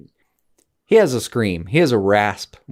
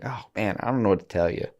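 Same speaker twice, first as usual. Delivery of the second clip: ordinary talk, nothing marked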